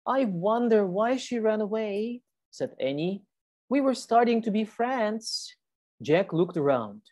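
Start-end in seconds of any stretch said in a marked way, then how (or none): 0.1-2.2 put-on girl voice. Annie voiced by teacher. 3.7-5.5 put-on girl voice. Annie voiced by teacher.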